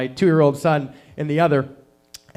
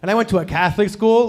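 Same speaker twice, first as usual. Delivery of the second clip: ordinary talk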